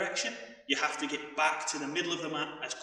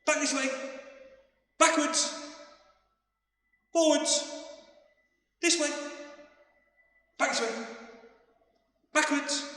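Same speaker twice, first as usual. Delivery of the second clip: high, loud called commands; shouting directions; 12 semitones higher, 7 dB louder than usual. Real, not acted. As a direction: bark each direction to the class. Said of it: "I'm gonna shout out different directions".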